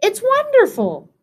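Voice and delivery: high, delighted bird cheep; high-pitched gleeful little bird. A narrator's reading. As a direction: cheep it with wonder. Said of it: "Bird cheeped".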